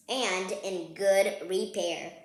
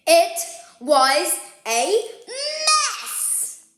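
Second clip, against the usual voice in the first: high, loud, stretched out mess; dramatic exclamation; 10 semitones higher, 8 dB louder, much slower than usual. A narrator's reading. Narrator announcing the cubs' messy room, drawn-out wail.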